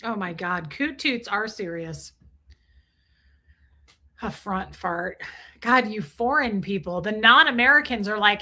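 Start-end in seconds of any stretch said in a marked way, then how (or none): none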